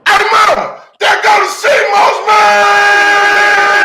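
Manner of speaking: high, drawn-out hawker's cry across the street; street hawker shout. Pretend. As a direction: holler it like a vendor calling customers. Street vendor call, hype shout.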